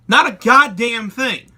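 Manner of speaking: loud slow emphatic verdict; emphatic answer. Real, not acted. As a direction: slam the answer down. Ranting answer to own question.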